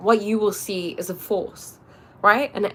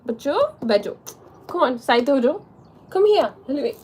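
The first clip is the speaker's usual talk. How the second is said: coaxing the cat; 5 semitones higher than usual. Real, not acted. Calling the cat over.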